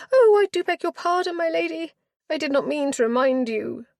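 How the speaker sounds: high, dismayed matron apologising; flustered apology. Acted. Matron begging her lady's pardon, high and flustered. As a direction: gasp out the apology, high and fluttering.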